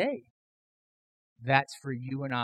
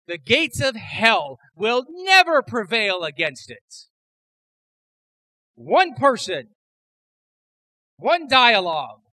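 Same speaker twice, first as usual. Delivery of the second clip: louder and higher, hammering each "one"; preaching at full force; 7 semitones higher, 6 dB louder than usual. Acted. Pastor to his church on Peter's confession, impassioned preaching. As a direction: pastor to congregation, driving the point home, building preaching.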